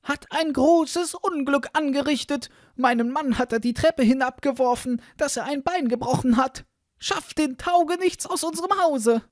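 high, loud wailing complaint; outraged wife's very high voice; loud outcry. A narrator's reading. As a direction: cry out the accusation at full pitch. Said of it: "eilte dann mit lautem Geschrei".

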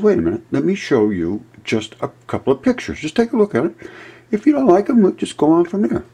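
high, loud eager plea; excited appeal to the skeptical group; eager pitch. Retold or imitated. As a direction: push hard to be heard out.

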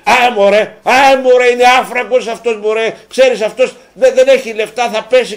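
scoffing at a club with no money; dismissive scoff. Retold or imitated. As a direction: wave him off as a broke nobody. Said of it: "εκεί που λες ότι".

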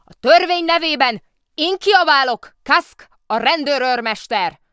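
locked-up policeman bellowing his authority; angry shouting. A narrator's reading. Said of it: "dühös kiáltozás ütötte meg a fülét".

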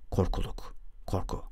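whispered, trailing into sleep; fading whisper. A narrator's reading. Drifting into sleep, murmuring to himself in a whisper. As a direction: let the words dissolve into breath.

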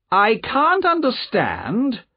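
high, slow aristocratic disdain; haughty, cold nobleman. A narrator's reading. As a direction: say it slowly and high, looking down on them. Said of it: "he said coldly".

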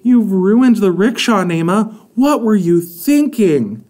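high, loud dismayed scolding; mother rebukes daughter over the wrecked rickshaw; upset scolding. A narrator's reading. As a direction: let the shock pour out at her daughter.

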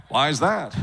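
put-on laid-back Los Angeles accent. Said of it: "everyone strives to sound like this".

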